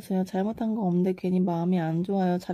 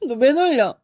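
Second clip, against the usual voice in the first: laughing protest; 8 semitones higher, 3 dB louder than usual. Real, not acted. Viewer teases her, she protests.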